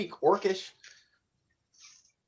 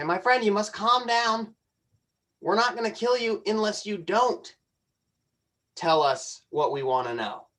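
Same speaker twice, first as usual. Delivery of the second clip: higher, firm calm-down order; in-character threat; 6 semitones higher than usual. Acted. Largo telling the screaming goblin to calm down, raised and firm. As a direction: lift the voice and steady the goblin. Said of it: "And I say".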